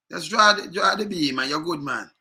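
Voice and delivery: ordinary talk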